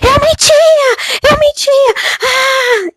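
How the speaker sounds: very high, drawn-out wailing shriek; terrifying scream. Acted. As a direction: scream the confession in agony, stretching it out. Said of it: "E ela gritava". (grito agudo e apavorante, chorado)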